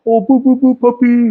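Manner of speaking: put-on high, cooing baby talk for the puppy; doggy baby talk. Owner cooing over his puppy when he picks her up, high baby talk. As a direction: coo at the dog like a baby. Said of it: "my voice goes to complete suck mode".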